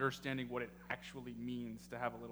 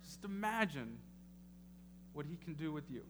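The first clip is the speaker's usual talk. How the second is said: rising preacher's build to the congregation; preaching; 3 semitones higher than usual. Acted. Pastor urging his congregation, building preaching. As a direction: drive the point home like a sermon.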